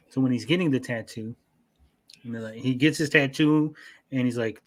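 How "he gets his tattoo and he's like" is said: ordinary talk, nothing marked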